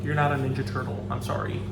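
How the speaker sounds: ordinary delivery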